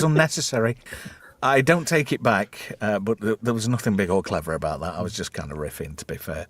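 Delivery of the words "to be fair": ordinary talk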